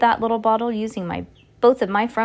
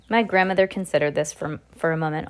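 ordinary delivery, nothing marked

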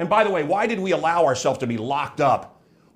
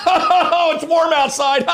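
loud, high, hammed-up guffawing; forced cackling over nothing; fake radio laughter; 12 semitones higher, 4 dB louder, slower than usual. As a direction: laugh hysterically through the words, mocking radio hosts. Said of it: "or they're laughing".

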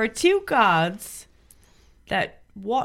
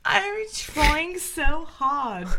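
put-on slow, higher British accent, laughing; strained British accent; 5 semitones higher, slower than usual. Guest defends her faltering British accent to the hosts. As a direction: push hard to hold the British accent. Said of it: "She's doing a great job".

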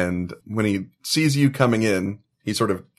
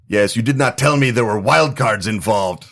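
put-on Slovak accent, higher, building to a shout; angry Slovak man yelling down a recorded line, louder and higher; 7 semitones higher, 5 dB louder than usual. Recorded Slovak man berating the caller, angry Slovak accent shouting. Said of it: "a guy with a Slovak accent".

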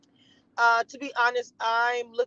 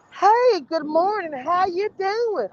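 higher, slow put-on greeting voice; Jasmine voice; 10 semitones higher, slower than usual. Shown as a demonstration. Airhead voice tried on the store clerk. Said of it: "I should do that shit".

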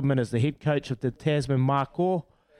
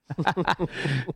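laugh; 6 semitones higher than usual. Real, not acted. Laughing at a listener text.

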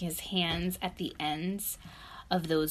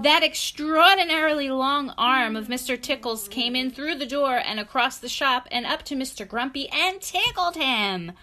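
louder, higher, building storybook telling; excited story narration; 6 semitones higher, 8 dB louder than usual. A narrator's reading. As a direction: drive the story towards the tickle. Reads story to child.

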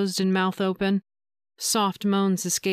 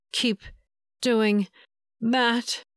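words pushed out with effort; a touch of grunting strain, slightly higher; mild effortful grunt; 3 semitones higher, much slower than usual. Acted. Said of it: "she grunted with the exertion".

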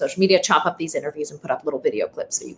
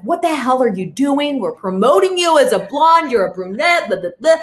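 mock angry boss; 5 semitones higher, 3 dB louder than usual. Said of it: "He's pissed off".

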